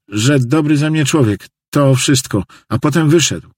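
high voice, recounting events to the sheriff; agitated janitor witness. Acted. (przejęty świadek, wysokim głosem)

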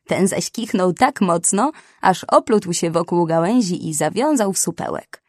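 ordinary delivery

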